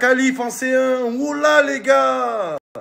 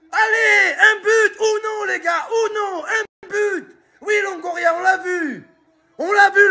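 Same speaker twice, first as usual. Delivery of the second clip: high frantic yelling; excited shouting; 9 semitones higher than usual. Real, not acted. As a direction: scream at the screen, desperate. Screaming at the match.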